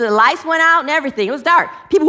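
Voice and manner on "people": ordinary talk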